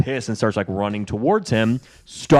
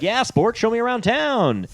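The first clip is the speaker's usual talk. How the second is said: high, chummy mock enthusiasm; overfriendly Kent; 12 semitones higher than usual. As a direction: lay on the false pal act, pitched up. Voicing Kent addressing the kid.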